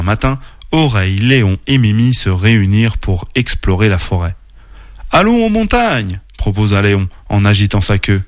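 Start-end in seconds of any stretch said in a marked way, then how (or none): 5.1-6.2 high, rallying call; rally friends in a raised pitch; eager proposal. Acted. Léon proposes an outing, eager high voice.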